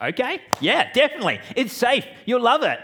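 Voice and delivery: high, loud, overeager reassurance; overselling. Retold or imitated. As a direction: sell it hard, too cheerful. Overselling the safety to kids.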